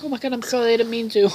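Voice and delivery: high, hurried mock-apologetic voice; mock apology. Retold apology after accidental punches.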